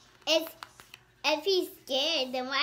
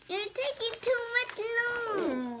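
imitating baby llama; 8 semitones higher than usual. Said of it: "he's talking like".

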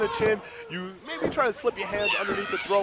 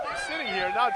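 squeaky yell. Acted. Insists on being left alone.

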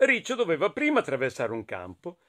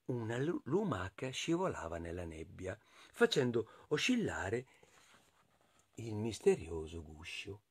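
soft, low storyteller near-whisper; hushed mystery; 6 semitones lower, 12 dB quieter than usual. A narrator's reading. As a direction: tell it hushed, as a mysterious secret. Mysterious sight in the fog. (sottovoce, con mistero)

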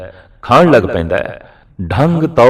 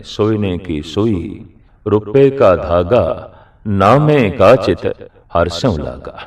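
slow, measured scripture recitation; verse recitation; slower than usual. Acted. Preacher quotes Namdev's verse to the congregation, slow recitation. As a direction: draw out each phrase of the sacred line.

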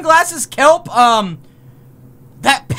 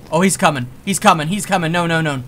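tense dread; 8 semitones lower, 4 dB quieter than usual. Real, not acted. Monster approaching the player.

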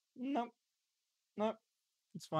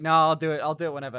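louder, dismissive brush-off; 1 mock lazy protest; 9 dB louder, faster than usual. Streamer mocks own excuses to chat, loud brushing-off tone. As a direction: shrug it off loudly, teasing yourself. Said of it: "I make jokes and be like".

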